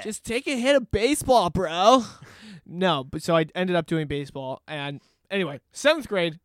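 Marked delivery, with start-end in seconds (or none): 0.0-2.0 loud, higher mock bro; bro peer pressure. Friends daring him onto the team, loud bro voice. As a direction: push it like a pushy bro. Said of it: "peer pressure bro voice".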